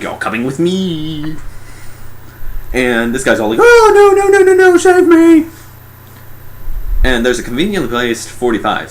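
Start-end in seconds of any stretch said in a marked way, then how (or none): 0.0-1.4 drawn-out, higher-pitched monster voice; alien abductor. Acted. Alien grabs one of the dudes, drawn-out menace. 3.6-5.5 high, loud hammed-up wail for help; panicked pleading. Guy grabbed by the alien, panicked high wail.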